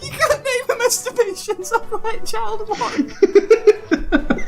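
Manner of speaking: high, slow, words through laughter; can barely get it out laughing; laughing while talking. Real, not acted.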